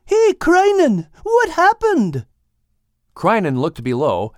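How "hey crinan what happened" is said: very high, slow, loud call upward; high drawn-out call to the sky; yelling up. Acted. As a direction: shout up to the birds flying overhead. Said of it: "yelled out to them".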